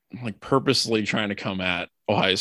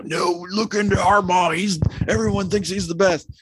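mock unhinged rant; 8 semitones higher, 4 dB louder than usual. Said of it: "unhinged response from Ryan Day".

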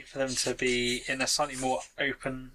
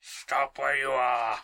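growly bear voice; slower than usual. Acted. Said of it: "it's like a growly bear voice".

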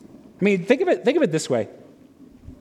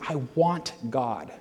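quiet inner voice; 6 semitones lower, 6 dB quieter than usual. Acted. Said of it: "what we're saying in those moments is".

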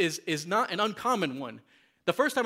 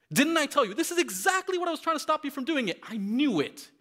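furious Jonah; 8 semitones higher than usual. Acted. Jonah accusing God of doing what he feared, high and furious. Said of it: "spit venom to God in this prayer".

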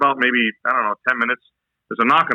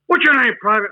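drill sergeant bark; 9 semitones higher than usual. Retold or imitated. Drill sergeant questioning recruit.

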